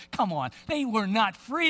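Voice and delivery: ordinary talk, nothing marked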